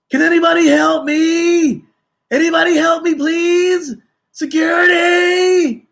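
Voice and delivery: very high, very loud desperate shouting; frantic screaming for help. Retold or imitated. Panicked passenger yelling for help across the checkpoint, shrieking. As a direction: scream for help as if someone is attacking you. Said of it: "goes off screaming and yelling".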